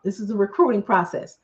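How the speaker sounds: ordinary talk, nothing marked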